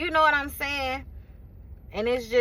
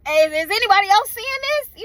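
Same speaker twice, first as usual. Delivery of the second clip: high, louder incredulous question; pitched-up, exasperated appeal; exasperated disbelief; 9 semitones higher, 5 dB louder than usual. Acted. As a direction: call out in disbelief to anyone listening. Disbelief in a frustrated rant.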